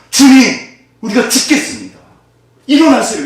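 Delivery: high, loud desperate cry for help; panicked plea. Acted. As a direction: shout it like men about to drown. Disciples cry out in storm. (겁에 질려 높고 크게 외치는 제자들)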